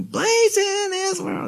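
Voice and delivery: a sudden very high, slow, strained belt; mock overblown singer straining up high. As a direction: ham up pushing the voice way too hard.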